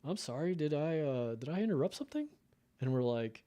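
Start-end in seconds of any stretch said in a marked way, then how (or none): 0.0-2.3 higher, brighter retold surgeon voice; a touch of the upbeat surgeon, pitched up; imitating the surgeon. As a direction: play the surgeon's cheerful surprise, voiced higher. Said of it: "And he's like".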